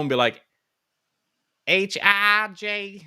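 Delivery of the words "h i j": high, very drawn-out hillbilly vowels; hillbilly accent. Retold or imitated. Child reciting the alphabet to mom, hillbilly accent. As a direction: stretch the I into a hillbilly "ah". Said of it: "Michigan accent that was kinda pushed into me".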